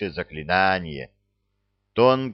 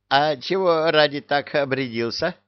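mocking taunt; 5 semitones higher than usual. A narrator's reading. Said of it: "начал издеваться бес".

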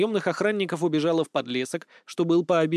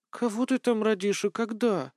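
drowsy wife's question, pitched higher; sleepy; 5 semitones higher than usual. A narrator's reading. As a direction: ask it half-asleep. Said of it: "сонно спросила Ольча".

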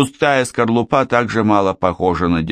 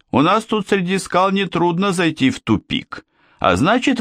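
high voiced king; 10 semitones higher than usual. King joking with Tupik.

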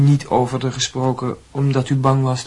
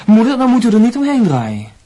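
insistent; 8 semitones higher, 5 dB louder than usual. Acted. Son presses evasive mother.

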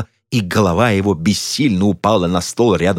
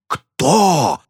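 roar; 7 semitones higher than usual. A narrator's reading. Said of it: "взревел хиромант".

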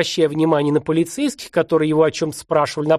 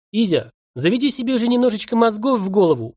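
put-on lively Odessa accent, Yiddish-tinged Russian; 6 semitones higher than usual. A narrator's reading. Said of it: "произнёс парень с одесским акцентом". (с одесским акцентом)

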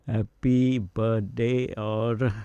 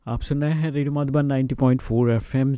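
radio announcer; 3 semitones higher, 4 dB louder, faster than usual. Acted. Radio station ident.

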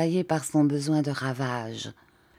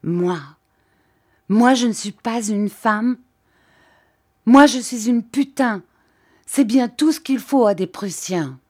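much louder, indignant outburst; vehement; 6 semitones higher, 6 dB louder than usual. A narrator's reading. Rachel hurls an insult at the Prussian, indignant. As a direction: hurl it at him. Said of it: "indignée et véhémente".

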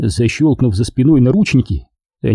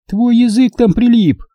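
woman's retort; 11 semitones higher than usual. A narrator's reading. Katya's retort.

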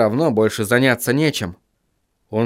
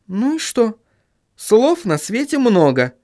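higher, lightly consoling female voice; affectionate young woman; 8 semitones higher than usual. A narrator's reading. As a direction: console him in a raised, gentle voice. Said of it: "Сахариса ласково потрепала его по щеке".

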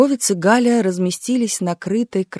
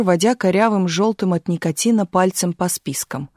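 ordinary delivery, nothing marked

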